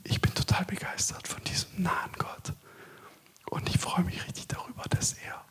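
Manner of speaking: intimate unvoiced whisper, close to the mic; hushed sermon whisper throughout; whispered preaching. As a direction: whisper it as if God is right beside you. Long whispered sermon section on God whispering.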